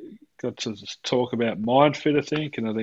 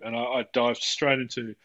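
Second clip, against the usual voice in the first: normal talking voice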